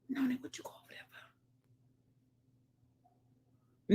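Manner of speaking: hushed, unvoiced question to the pet; whisper to pet. Real, not acted. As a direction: whisper it so the pet barely hears. Talking to pet softly.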